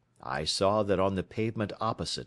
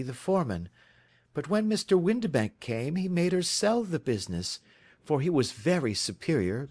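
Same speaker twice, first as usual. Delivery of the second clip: Mary Sutherland voice; 8 semitones higher than usual. A narrator's reading. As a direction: lift into Mary's earnest, simple voice.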